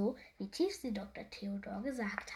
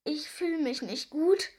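slow, higher-pitched complaint; murmuring; 5 semitones higher, 5 dB louder than usual. A narrator's reading. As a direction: unwell storybook moan, drawn out. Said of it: "murmelte Tiefsee Doktor Theodor".

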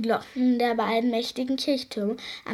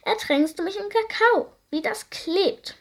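shrieking creature; 8 semitones higher than usual. A narrator's reading. Creature accuses Biene.

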